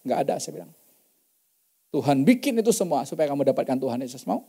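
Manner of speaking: normal talking voice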